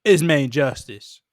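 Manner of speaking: slow Southern sketch-comedy judge; slow, mock courtroom pronouncement; Jason Sudeikis' Main Justice judge. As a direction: drawl it like a theatrical TV judge. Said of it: "Jason Sudeikis' uh, uh, Judge from the S N L sketch".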